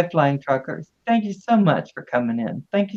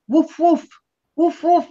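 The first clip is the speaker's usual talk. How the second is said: high, bright puppy barks, much higher in pitch; playful woof woofs; 11 semitones higher than usual. Pretend. Greeting the puppy Nell on stream with high playful woofs. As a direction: bark at the puppy like a dog, pitched way up.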